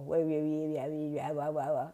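low, soft garbled wah wah; a lightly voiced muffled dad mumbling down the line; imitated mumbling. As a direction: mimic dad's mumble through static. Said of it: "my dad loved to mumble".

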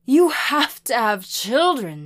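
loud, high distraught wail; hysterical, pleading outburst. A narrator's reading. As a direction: wail it at your child. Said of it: "she wailed".

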